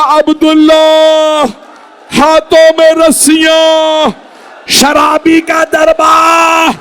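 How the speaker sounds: high, slow mourning cry; anguished wail. Acted. Zainab answering Abdullah about her captivity, high slow wail. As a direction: cry out the memories of pain.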